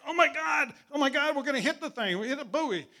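high, loud, rushed cry of alarm; acted panic. Warning the skipper of a buoy ahead, in acted panic. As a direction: re-enact yelling out in sudden panic. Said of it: "she could hear the panic in my voice".

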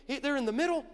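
ordinary talk, nothing marked

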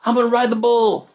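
high, excited party shout. Retold or imitated. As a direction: call it out to the whole patio. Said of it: "shouts to everybody".

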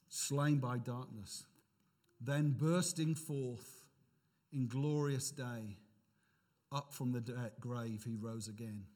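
slow, low recitation of lyrics; solemn hymn reading. Acted. Preacher reads an old hymn before communion, solemn and slow. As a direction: recite each phrase slowly and reverently.

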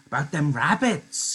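put-on Lenny pressing the point like a child; insisting Lenny. Lenny insisting to Candy about the rabbits, slow and childlike. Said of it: "he insisted".

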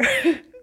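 a quick laugh at herself; sheepish chuckle; short embarrassed laugh. Real, not acted. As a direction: laugh it off mid-sentence. Laughs at own yawn.

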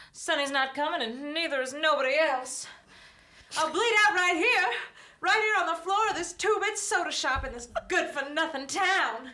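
high, desperate dying woman; a bleeding dame's frantic last words. Acted. Acted dying dame, bleeding out.

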